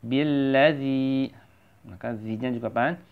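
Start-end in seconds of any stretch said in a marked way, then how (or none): 0.0-1.3 "Billazi" slowly with the final zi stretched; deliberate long-vowel demo of "Billazi". Shown as a demonstration. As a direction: hold out the zi at the end of "Billazi". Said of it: "kita baca panjang".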